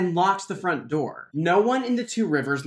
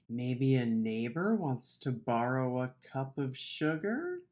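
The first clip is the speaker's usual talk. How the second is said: naive hush; 6 semitones lower, 10 dB quieter than usual. Acted. Naive guess after a thump.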